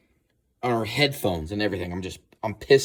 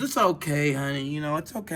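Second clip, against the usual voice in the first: higher reassuring woman's voice; imitating her comfort; 7 semitones higher than usual. Girlfriend comforting him, higher gentle mock voice. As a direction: soothe him in a higher pitch. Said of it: "She goes".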